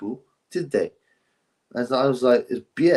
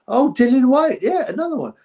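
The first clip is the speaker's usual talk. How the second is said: excited recall; 13 semitones higher, 5 dB louder than usual. Real, not acted. Suddenly remembers another example.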